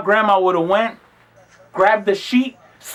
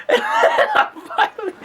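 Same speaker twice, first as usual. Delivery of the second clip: high laughter into words; laughing. Real, not acted. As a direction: laugh through the start of the line. Laugh heard.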